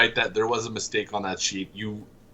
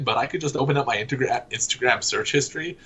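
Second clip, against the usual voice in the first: ordinary delivery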